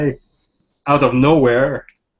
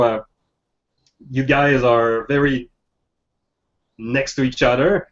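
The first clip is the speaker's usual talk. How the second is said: ordinary delivery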